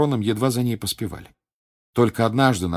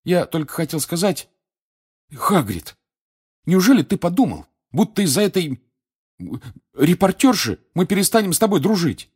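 earnest; 11 semitones higher, 6 dB louder than usual. A narrator's reading. Harry reassuring Hagrid.

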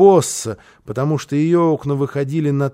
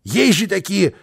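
high, exasperated cry, building louder; indignant shouting; 6 semitones higher, 5 dB louder than usual. A narrator's reading. Карлсон demands boy react, shouting in outrage. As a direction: shout in disbelief, stretching the key words. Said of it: "крикнул".

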